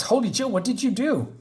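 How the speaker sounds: high-pitched startled question; acted alarm. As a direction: play a startled mob boss. Boss startled by the wailing.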